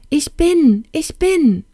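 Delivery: high, loud cry of joy; exult, pitch leaping right up; jubilant cry. A narrator's reading. Princess freed of her felt shoes, jubilant cry. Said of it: "mit einem Jubelschrei rief sie".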